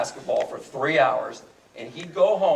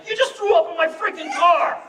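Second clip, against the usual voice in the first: high panicked yell, much higher; freaking out; 12 semitones higher, 4 dB louder than usual. Retold or imitated. Driver yelling at drunk friend who vomited, panicked yell. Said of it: "So I'm freaking out".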